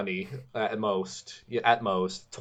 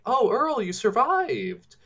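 much higher, squealing "Oh, Earl, you survived"; mock gushing girl; 13 semitones higher, slower than usual. Mocking the redhead greeting Earl, squealing high. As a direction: gush like a ditzy horror heroine. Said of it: "just like".

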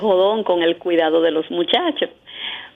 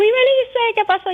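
panicked father; 13 semitones higher than usual. Retold or imitated. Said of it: "Ese hombre se quería morir".

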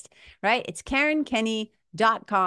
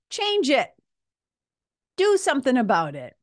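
emphatic; 5 semitones higher, 4 dB louder than usual. Real, not acted. Urging listeners to act.

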